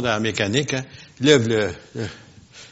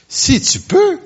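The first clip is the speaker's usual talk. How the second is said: "Si tu peux" shouted high with a sharp rise on "peux"; exclamatory; 6 dB louder, slower than usual. Shown as a demonstration. Preacher stressing Jesus' words to the congregation, high exclamation. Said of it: "point d'exclamation".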